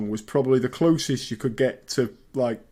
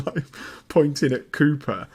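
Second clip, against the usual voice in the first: ordinary talk, nothing marked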